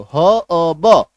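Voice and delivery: ह अ ब slowly separated, no R; sound by sound. Shown as a demonstration. Teacher breaking the word down, ह अ ब one at a time. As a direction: break हर्ब into ह, अ, ब. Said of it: "ये देखो".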